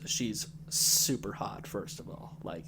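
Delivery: whispered and slow; dreamy hush. Acted. Host painting his fantasy wife in a slow hushed whisper. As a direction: savour it in a low hush. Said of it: "Can I have my moment?".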